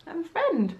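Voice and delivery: high, sing-song pet talk; cooing at the cat. Real, not acted. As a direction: coo it to a cat that just walked in.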